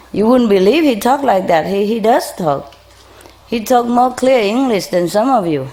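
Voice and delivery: usual speaking voice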